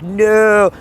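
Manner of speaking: mock audience boos; heckling audience. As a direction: call it out high like a jeering crowd. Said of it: "the audience is like".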